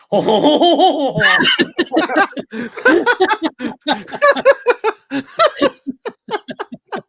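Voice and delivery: long, high, loud ho-ho guffaw; hammed-up French laugh. Storyteller reacting to the word France, hammed-up French laugh. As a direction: burst into a long cartoonish ho-ho laugh.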